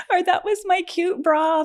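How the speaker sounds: high, loud laughing lament; mock lament. Patient loses her cute bra, high laughing mock lament. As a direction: play up the dismay while laughing.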